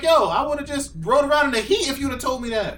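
high, loud disbelieving outburst; outraged disbelief. Retold or imitated. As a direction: blurt it out, shocked and high. Retold outraged reply to price.

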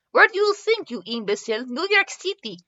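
put-on high, scornful French accent; heavy French accent. A narrator's reading. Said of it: "with a heavy French accent".

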